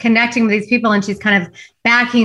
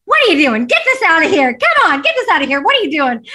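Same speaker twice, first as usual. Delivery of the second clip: a higher, hammed-up angry bark; mock yelling principal; 5 semitones higher than usual. Principal scolding Andrew over the bookcase, mock yelling. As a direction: play the bossy principal scolding, half mock. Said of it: "He's, like, yelling at him".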